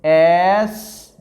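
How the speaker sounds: "एसपी" with a long held "एस", then "पी"; drawn-out pronunciation. Shown as a demonstration. Teacher demonstrating the pronunciation to students, "एसपी" stretched out. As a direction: hold the first sound of "एसपी" slowly. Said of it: "अब इस उच्चारण देखते हैं इसका".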